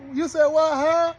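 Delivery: high-pitched baffled self-mimicry; confused reaction. Preacher mimics himself tripping, high and baffled. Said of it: "I be like".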